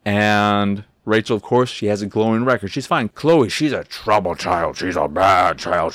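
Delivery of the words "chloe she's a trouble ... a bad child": hammed-up grave condemnation; mock disapproval. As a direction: drag it out like a mock stern judgment. Mocks the adults' view of Chloe.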